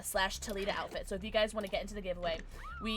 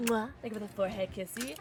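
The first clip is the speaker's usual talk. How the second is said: ordinary delivery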